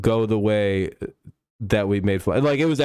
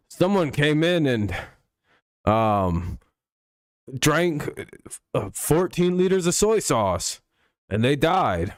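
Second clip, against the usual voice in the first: imitating YouTube doctor; 5 semitones higher, slower than usual. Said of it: "he's always like".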